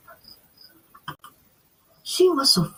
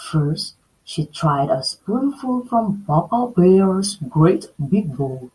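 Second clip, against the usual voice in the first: ordinary delivery, nothing marked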